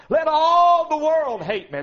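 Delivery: defiant preaching cry; ringing declaration. Real, not acted. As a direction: proclaim it to the rafters.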